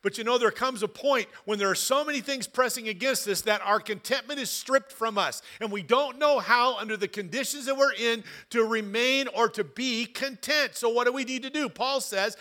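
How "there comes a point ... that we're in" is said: ordinary delivery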